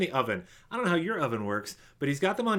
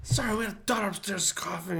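whispering; 4 semitones higher than usual. Real, not acted. Daughter upstairs, whispered explanation.

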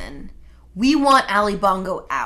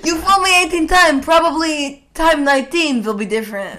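laughing mock Russian accent, higher and louder; hammed-up Russian accent through laughter; 5 semitones higher, 3 dB louder than usual. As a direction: fake Russian proverb, laughing. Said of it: "so funny in a Russian accent".

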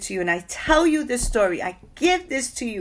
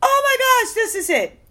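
much higher and louder burst of mock delight; excited exclamation; 14 semitones higher, 4 dB louder than usual. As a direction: squeal the discovery you never actually made. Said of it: "I did not say".

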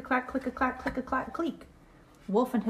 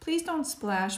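ordinary delivery

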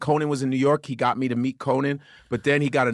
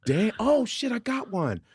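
feigned surprise; 9 semitones higher, slower than usual. Acted. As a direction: fake a sudden lucky discovery. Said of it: "pretend to look around and go".

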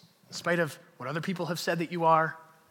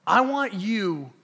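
loud, emphatic preacher's charge; rousing exhortation; 4 semitones higher, 7 dB louder than usual. Real, not acted. Preacher urging congregation to be overcomers, rousing exhortation. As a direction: press it on the congregation.

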